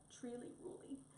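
whispered, softly sorry; sad whisper. Acted. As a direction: hush it out, sad for the bear. Wombat sad about Tedda.

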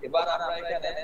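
distant call echo. Real, not acted. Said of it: "ইকো হচ্ছে কোনো কারণে".

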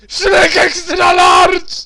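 slow booming trailer announcer; announcer shout. Retold or imitated. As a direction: bellow the product name like an ad. Wykrzykuje nazwę zabawki z reklamy. (krzyk lektora z reklamy)

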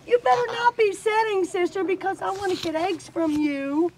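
high-pitched warning to a pet hen; playful scolding. Real, not acted. Keeper warning a hen not to set, high playful scolding.

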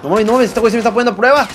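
high, rapid-fire alarm; frantic agitation. Real, not acted. Streamer being tested by Brandon, frantic agitation.

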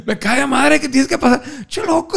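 high, laughing pal voice; mock friend pitched high; imitating an insistent friend. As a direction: push him hard, half joking.

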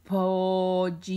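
"Pollito" slow and low, vowels held long; drawn-out, level-toned "Pollito"; stretched word. Shown as a demonstration. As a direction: stretch out every sound of "Pollito". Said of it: "Estiren la palabra".

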